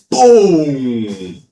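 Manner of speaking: loud falling boom of an impact; big sustained sound-effect boom; drawn-out boom. Retold or imitated. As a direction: boom it out like an explosion, pitch sliding down. Imitated impact in retold fight.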